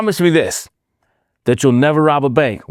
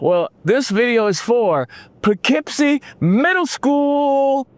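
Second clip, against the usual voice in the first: drawn-out announcement; 9 semitones higher, much slower than usual. Shown as a demonstration. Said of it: "The crowd should be going wild right now".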